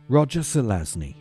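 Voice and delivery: normal talking voice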